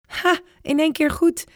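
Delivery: high, pleased-with-himself cheer; proud. Acted. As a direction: crow a little about getting it right. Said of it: "zegt opa vol trots".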